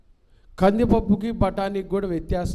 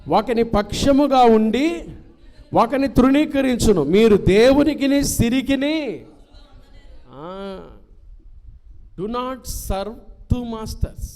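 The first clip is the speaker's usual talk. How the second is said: high, loud, slow proclaiming; scripture reading; 6 semitones higher, 7 dB louder, slower than usual. Acted. Preacher reading Matthew to the youth, loud and slow. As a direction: proclaim each phrase of the verse.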